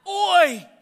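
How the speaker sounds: loud, high mock-Australian shout; Australian reaction. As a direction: holler it like a jokey Australian. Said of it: "That must be the Australian reaction".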